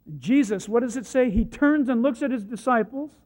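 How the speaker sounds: ordinary talk, nothing marked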